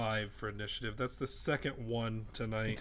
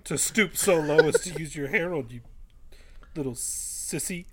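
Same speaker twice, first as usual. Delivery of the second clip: mock-tough taunt, building louder; drawn-out insults rising to a loud challenge; taunting challenger; 4 semitones higher, 6 dB louder than usual. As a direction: goad the villain into a fistfight. Player's character taunts the villain.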